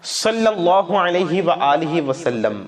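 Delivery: slow, reverent recitation of the salutation; slow devotional chant; devout recitation. Shown as a demonstration. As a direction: recite it as in prayer, slowly. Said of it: "آپ یہ بھی پڑھ سکتے ہیں".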